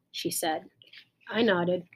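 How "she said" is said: ordinary delivery, nothing marked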